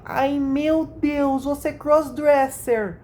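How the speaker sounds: high, drawn-out mock gasp; mock shocked girly voice; imitating her voice. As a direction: read her message in a put-on high voice. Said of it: "eu leio imitando a voz delas".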